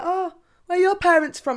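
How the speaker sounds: high, loud nosy question; nosy coworker. Retold or imitated. New coworkers asking about her parents, high loud prying. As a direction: play the curious new colleague, up high and loud. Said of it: "they're like".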